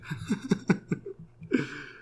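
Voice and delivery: breathy burst of giggles; helpless giggle; laughter. Real, not acted. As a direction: laugh it out in a quick run. Laughing at friend's remark.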